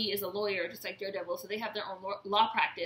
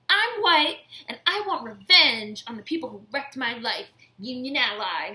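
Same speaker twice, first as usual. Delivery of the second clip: mocking Karen; 2 semitones higher, 7 dB louder than usual. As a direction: overblown vengeful whine. Quoting Karen's line mockingly.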